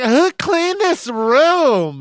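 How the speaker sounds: very high, slow imitation of disgust; mock snooty newcomer. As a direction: mimic her complaint, stretched and sky-high. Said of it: "She's like".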